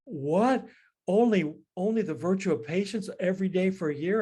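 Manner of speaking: surprised. Retold or imitated. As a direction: play the priest's startled disbelief. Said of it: "surprised and he says".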